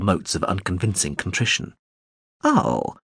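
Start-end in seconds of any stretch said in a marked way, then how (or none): 2.4-3.0 bright drone voice. A narrator's reading. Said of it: "Morin Skell said brightly".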